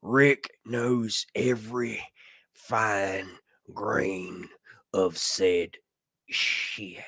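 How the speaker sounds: lightly voiced slow tough-guy impression; word by word, with long pauses; Abraham. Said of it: "this is my Abraham from The Walking Dead".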